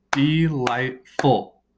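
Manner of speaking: delightful split into de-light-ful, drawn out; delightful broken into three slow, separate beats; syllable by syllable. Shown as a demonstration. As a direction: say DE-LIGHT-FUL slowly, one beat per syllable. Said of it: "clap out the sounds".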